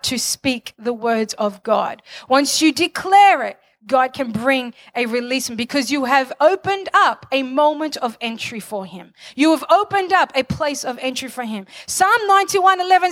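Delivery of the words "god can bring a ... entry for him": ordinary talk, nothing marked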